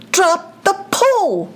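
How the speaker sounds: high shouted command; urgent shout. Acted. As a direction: yell the order desperately. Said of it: "he shouted".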